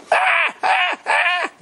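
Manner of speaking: very high-pitched imitated baby shrieks; mock toddler crying. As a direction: shriek in bursts like a bawling baby. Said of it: "He keeps crying".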